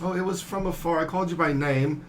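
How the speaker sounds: in-character defence. Acted. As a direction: defend yourself to the one scolding you. Player answers scolding in character.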